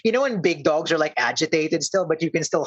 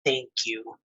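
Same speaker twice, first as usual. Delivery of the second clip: low, quiet, reluctant thanks; trouble saying it; 5 semitones lower, 6 dB quieter than usual. Acted. Bear thanks those who freed him, struggling to say it. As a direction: force out unfamiliar words softly. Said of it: "trouble saying those words".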